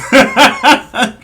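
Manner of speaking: high, loud burst of laughter; big amused cackle. Real, not acted. Laughing at them laughing in faces.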